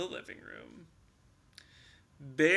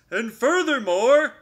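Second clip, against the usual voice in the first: bear holding forth; 8 semitones higher, 12 dB louder than usual. Acted. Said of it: "continued Bear".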